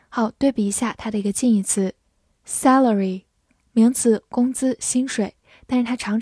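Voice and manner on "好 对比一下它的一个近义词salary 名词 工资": usual speaking voice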